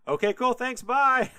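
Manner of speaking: high, loud, quick thanks and goodbye; hasty brush-off. Retold or imitated. Jacob to his father before fleeing, hasty and high-pitched. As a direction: chirp it high and loud, eager to get away. Jacob hurriedly escapes with the blessing.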